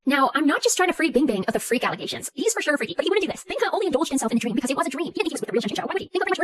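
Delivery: gabbled, sped-up rambling plea; frantic defence. Real, not acted. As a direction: race through the excuses without a breath. Frantic defence of Binghe, cut off.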